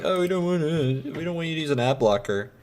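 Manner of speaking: high whiny mock of YouTube pleading; lightly voiced whiny YouTube, fast at the end. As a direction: sneer it out as the platform. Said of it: "YouTube be like".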